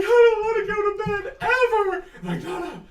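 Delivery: high, whiny protest; whining kids. Acted. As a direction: whine like a tired child. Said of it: "all the kids are like".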